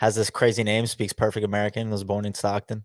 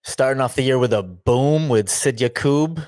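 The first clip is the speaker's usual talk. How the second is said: usual speaking voice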